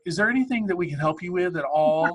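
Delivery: lightly voiced overly polite flight attendant; mock-sweet helpful stewardess tone. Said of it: "she turned to me and she's like".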